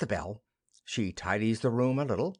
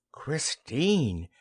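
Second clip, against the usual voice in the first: ordinary delivery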